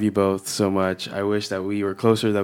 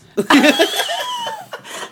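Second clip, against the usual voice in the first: squealing laugh, pitched way up; high burst of laughter. Real, not acted. Cohost laughing at her own period joke, squealing laugh.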